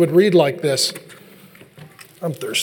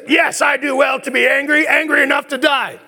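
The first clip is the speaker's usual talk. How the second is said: higher, shouted tantrum; kid throwing a fit; 5 semitones higher than usual. Retold or imitated. Jonah answering God, a mock childish tantrum shout. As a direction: yell it like a sulking child.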